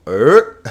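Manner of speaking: "er" with the pitch sliding up, loud; loud demonstration "er" on a rising pitch. Shown as a demonstration. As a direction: slide the pitch upward on "er". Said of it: "pronounced as a rising tone".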